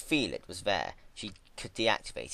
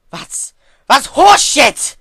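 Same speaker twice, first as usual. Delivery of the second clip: very high, loud, slow screaming outburst; furious yell; 20 dB louder, slower than usual. A narrator's reading. Twilight screaming in denial at Celestia. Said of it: "she yelled".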